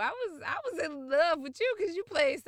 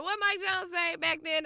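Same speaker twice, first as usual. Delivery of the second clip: ordinary delivery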